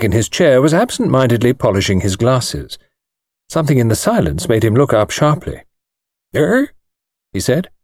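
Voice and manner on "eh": put-on an old man's thin, higher, precise voice; lightly voiced elderly lawyer, startled. A narrator's reading. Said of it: "thin, precise little voice".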